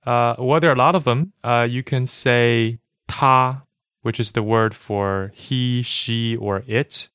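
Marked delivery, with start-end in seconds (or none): 3.1-3.6 flat, sustained "ta"; first tone. Shown as a demonstration. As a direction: say "ta" on one steady pitch. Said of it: "other words in Chinese that are in first tone".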